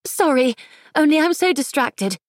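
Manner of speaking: put-on fretful society girl, clipped upper-class English; clipped upper-class English accent. A narrator's reading. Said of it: "the girl's clipped accent".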